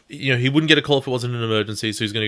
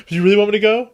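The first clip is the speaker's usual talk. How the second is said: pleading; 10 semitones higher, 8 dB louder, much faster than usual. Retold or imitated. Joe pleads to stay.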